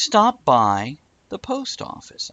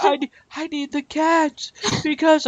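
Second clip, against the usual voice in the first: much higher, broken near-sob; tearful stammer; 12 semitones higher than usual. Retold or imitated. Friend imitating wife crying in the car, high broken stammer. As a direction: stammer it through imitated tears. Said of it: "she's getting worse".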